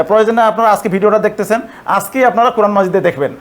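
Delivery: normal talking voice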